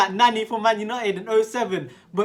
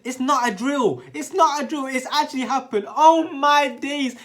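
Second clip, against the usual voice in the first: loud, fired-up disbelief at a wonder goal; excited; 4 semitones higher, 5 dB louder than usual. Real, not acted. Vlogger hyping his friend's top-corner goal to viewers, loud excitement. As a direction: shout it like you can't believe what you just saw.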